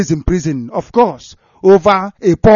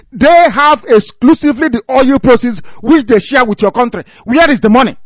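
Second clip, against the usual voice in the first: angry rant; 7 semitones higher, 5 dB louder than usual. Real, not acted. Ranting at the British newspaper.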